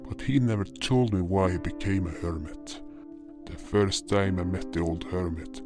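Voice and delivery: ordinary delivery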